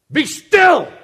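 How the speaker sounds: sudden loud, high command; explosive order out of a hush; commanding shout. Acted. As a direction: shout it to silence a storm. Acts Jesus commanding the storm.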